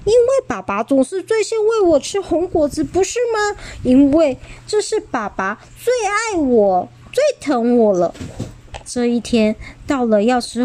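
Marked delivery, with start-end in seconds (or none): 0.0-8.1 put-on higher, proud child voice; smug boast. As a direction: gloat about being first. Xiaolu boasting again.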